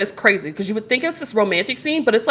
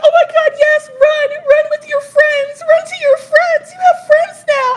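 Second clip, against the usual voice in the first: high, loud squealed cheering; fangirl screaming; 6 dB louder than usual. Retold or imitated. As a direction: shriek encouragement at the screen. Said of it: "I am, like, screaming".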